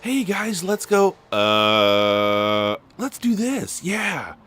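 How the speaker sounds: mocking his own intro. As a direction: send up your own video intro. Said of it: "It's like".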